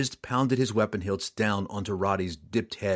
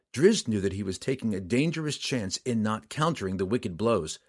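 ordinary delivery

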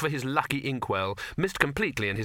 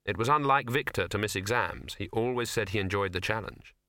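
usual speaking voice

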